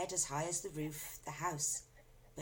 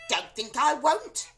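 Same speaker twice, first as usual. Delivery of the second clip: loud, slow threat; menacing grandma; 9 semitones higher, 12 dB louder, slower than usual. A narrator's reading. Grandma threatening to take the cup. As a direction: drag it out threateningly. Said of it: "Grandma said".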